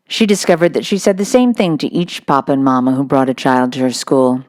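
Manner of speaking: plain reading voice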